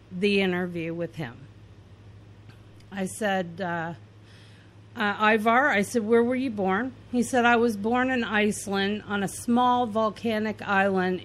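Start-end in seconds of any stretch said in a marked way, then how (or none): none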